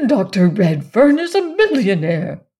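loud, excited exclamation; agitated exclamation. A narrator's reading. Mother grasping her daughter married money, agitated and loud. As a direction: blurt it out, thrilled despite herself. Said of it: "Missus Frederick was visibly agitated".

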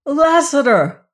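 high, loud wondering cry; thrilling cry. Acted. Venters recognizing the rider, thrilled cry. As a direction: cry out the name in awe. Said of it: "thrilling cry".

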